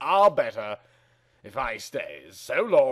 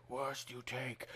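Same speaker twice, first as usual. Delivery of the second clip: much softer, lower echo; quiet echo; 3 semitones lower, 19 dB quieter than usual. Acted. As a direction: repeat it back like a faint echo. Echo of the line before.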